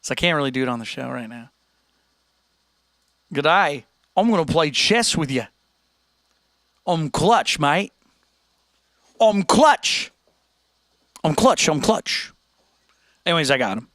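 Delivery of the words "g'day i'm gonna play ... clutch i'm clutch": put-on Australian kid accent; hammed-up Australian imitation. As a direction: do a broad Aussie trash-talker. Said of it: "I gotta think of an Australian accent real quick".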